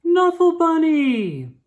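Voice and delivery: high, loud, drawn-out delighted shout; joyful cry. Acted. Trixie shouts on finding her bunny. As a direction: cry out the name in sheer joy.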